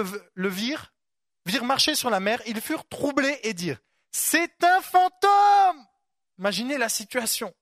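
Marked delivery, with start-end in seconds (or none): 4.1-5.9 loud, slow, high cry of terror; terrified shout. Retold or imitated. Preacher acting the disciples' terrified cry. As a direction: scream it like you've seen a ghost.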